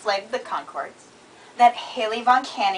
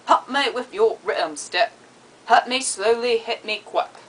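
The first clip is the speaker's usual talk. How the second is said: exaggerated New Zealand vowels, hit turned to hut; deliberate New Zealand accent demo; 2 semitones higher than usual. Shown as a demonstration. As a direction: read it out phonetically in New Zealandish. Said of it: "phonetically in New Zealandish".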